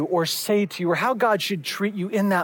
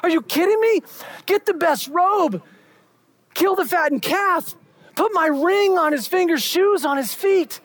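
overjoyed father; 8 semitones higher than usual. Retold or imitated. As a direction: burst out like a father who can't believe his son is home. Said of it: "He's like".

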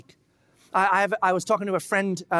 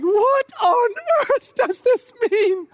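much higher, louder, slow anguished yell; exasperated cry; 8 dB louder, much slower than usual. Retold or imitated. Reader confused by Scripture, high exasperated cry. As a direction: cry out at the page in disbelief.

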